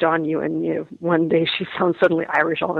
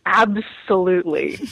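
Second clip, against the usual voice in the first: laughing; 2 semitones higher, slower than usual. Real, not acted. Laughing at turkey story.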